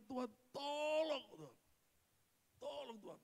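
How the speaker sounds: calling out to God for help, pitch up; desperate retold plea, high and stretched; crying out to God. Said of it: "Saya teriak".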